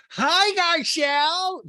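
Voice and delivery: shrieked mock hello across a room; Rinna. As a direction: call out very high, loud and drawn out. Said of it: "from across the room".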